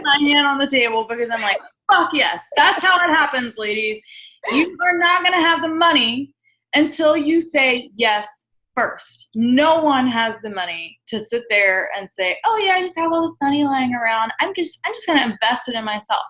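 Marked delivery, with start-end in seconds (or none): 1.9-9.3 higher, fired-up cheer of agreement; emphatic repeat. Real, not acted. Host cheering the guest's point to listeners, fired up. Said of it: "I want to say that again". 12.5-16.2 mock. Mocking an unrealistic money claim.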